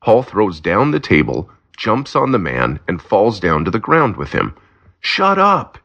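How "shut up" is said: sharp, high-pitched shout. A narrator's reading. Paul yells at the thief he has pinned, high shout. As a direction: yell it down at the thief. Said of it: "he shouts".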